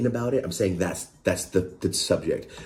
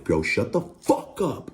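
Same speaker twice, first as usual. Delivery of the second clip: higher, drawn-out mock yell, no real volume; exasperated mild mock yell; retold yelling; 4 semitones higher, slower than usual. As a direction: re-enact losing patience, stretching the words. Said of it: "I started yelling".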